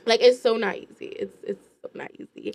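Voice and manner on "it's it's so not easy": whispered, much softer, all breath; murmured under the breath. Real, not acted. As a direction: drop to a hushed whisper to stress it. Repeats the line, then drops to a whisper.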